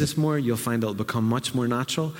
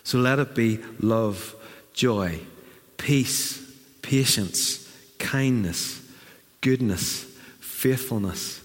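slower, fading list, each word falling; a quiet, tender benediction; softening blessing; 5 semitones lower, 3 dB quieter than usual. Acted. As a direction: let each fruit settle gently.

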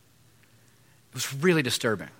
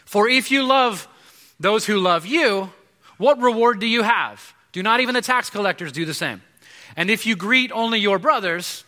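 scripture reading; 5 semitones higher, 4 dB louder than usual. Acted. Pastor reading the Bible to the congregation, preaching loudly. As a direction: proclaim the verses to the room.

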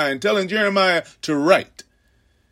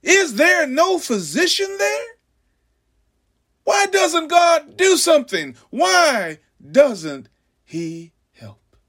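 higher, pleading cry rising to a shout; anguished prophet's cry; 11 semitones higher than usual. Acted. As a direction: cry out to heaven like a grieving prophet. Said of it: "And I say".

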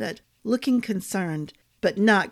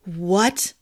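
ordinary delivery, nothing marked

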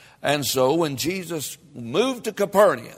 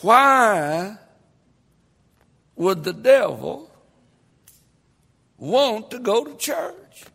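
louder, rising preacher's cadence, punching phrases; preaching at full force; 3 semitones higher, 5 dB louder than usual. Real, not acted. Preacher stirring up the congregation, fiery and loud.

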